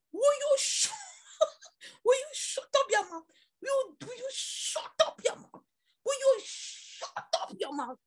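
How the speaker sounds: high-pitched acted scolding, dragging the last repeat; retold mother's scolding. Retelling mother saying shut up, high-pitched scolding. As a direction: act out an exasperated mother silencing someone.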